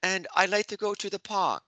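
high-pitched, badly faked English accent; amateur put-on English schoolboy accent; attempted English accent. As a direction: try too hard to sound English. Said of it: "like a guy trying to sound English".

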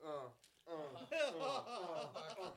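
puckered, straining through sour candy; eating sour candy. Real, not acted. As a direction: struggle to talk past a sour mouthful. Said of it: "I can't do it".

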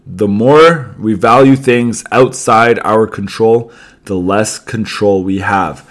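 slow, deliberate quote reading; slow second reading. Shown as a demonstration. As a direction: read it again slowly so it sinks in. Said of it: "One more time".

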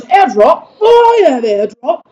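excited mock-British outburst, high and loud; slow, drawn-out delighted British imitation; Smith. Classmate imitates British soldier thrilled about airdrop, excited. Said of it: "Smith got really excited".

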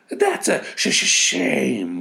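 very high, loud stuttered hiss; hissing blizzard. Acted. Exhausted blizzard cursing the girl, high stuttering hiss. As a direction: spit the stuttering words. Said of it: "one of them hissed".

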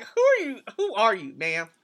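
usual speaking voice

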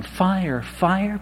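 a shy man imagines a neighbour hearing him call fire, barely calling out; mock timid yell. Embarrassed, held-back call of fire. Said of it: "Here I am yelling".

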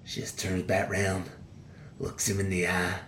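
ordinary talk

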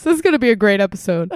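ordinary talk

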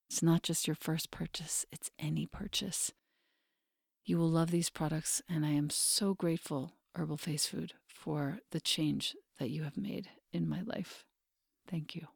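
soft, intimate sponsor read. Acted. Host reading a skincare ad to her listeners, soft and intimate. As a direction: coax listeners gently toward the product.

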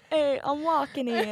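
playful New York street heckle; mock New York accent. Joins the New York riff.

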